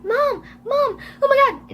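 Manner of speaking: frantic high calls for help; yelled. A narrator's reading. Emily yells for her mother, high and building. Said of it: "Emily yelled".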